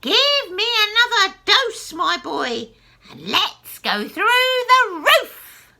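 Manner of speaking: very high, loud crone screech; a screechy old woman crowing. Acted. Grandma screeching down from the attic. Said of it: "the old screechy voice".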